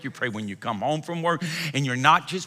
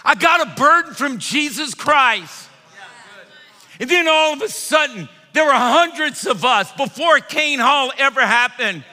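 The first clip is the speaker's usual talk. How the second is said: loud, high, driving sermon climax; preaching at full force; 11 semitones higher, 7 dB louder than usual. Acted. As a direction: shout it to the congregation.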